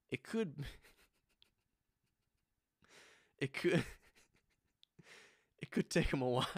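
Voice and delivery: words broken by giggles; laughing while talking. Real, not acted. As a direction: laugh through the line. Laughing mid-sentence.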